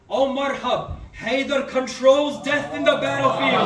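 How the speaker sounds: loud, high, rising declaration of power; thundering proclamation. Acted. Preacher voicing Imam Ali, thundering back at Marhab's taunt. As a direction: hurl each line at the enemy, hammering he will die.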